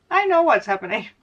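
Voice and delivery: chuckling through the words; laughing knowingly. Real, not acted. Answering her own joke with a laugh. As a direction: laugh it off, you know the answer.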